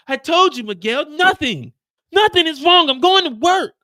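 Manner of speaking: high, loud exasperated shout; angry sister. Retold or imitated. Mireya yelling at Miguel that nothing is wrong, high and loud. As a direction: shout him down.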